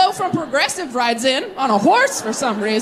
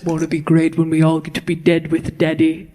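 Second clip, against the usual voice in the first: creepy preacher; 6 semitones lower than usual. Retold or imitated. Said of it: "the underlying message is".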